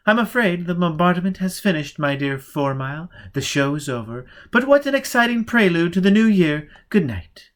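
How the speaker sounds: gracious snow maiden dismissing him; lady turning cool and polite. Acted. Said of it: "she was Lady Olivia again".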